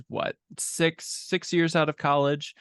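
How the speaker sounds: ordinary talk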